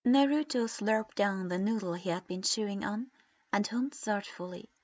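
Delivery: ordinary delivery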